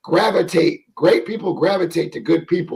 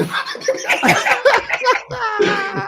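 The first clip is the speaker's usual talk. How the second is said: long, high peal of laughter; laughing. Real, not acted. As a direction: burst out laughing for real. Laughs at degree joke.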